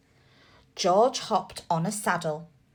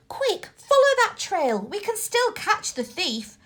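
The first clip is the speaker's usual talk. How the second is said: eager urging; 10 semitones higher than usual. A narrator's reading. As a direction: spur the dog on the trail.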